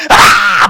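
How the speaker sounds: 벌을 보고 놀라 지르는 흉내 비명; 짧고 크게 '아!' 하고 외치기; imitated scream. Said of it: "막 소리 지르고". (땡벌을 만난 여자들 흉내, 짧은 비명)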